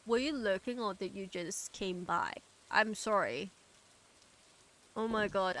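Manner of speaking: ordinary delivery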